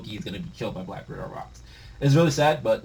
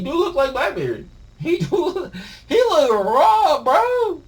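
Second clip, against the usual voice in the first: much higher excited gushing; hyped; 7 dB louder, slower than usual. Real, not acted. Reader gushing over Zebek's design, excited. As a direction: gush over his look.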